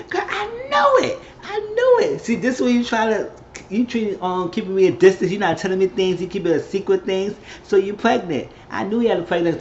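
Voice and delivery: high-pitched imitation of friend's outburst; retold excited accusation. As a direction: act out a friend who guessed the secret. Retold friend's reaction to pregnancy news.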